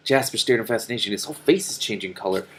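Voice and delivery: ordinary delivery, nothing marked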